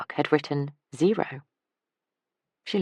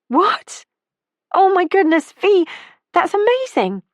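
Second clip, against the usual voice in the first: high, loud delighted surprise; thrilled for her friend; excited; 8 dB louder than usual. Acted. Ellie hears friend's good news.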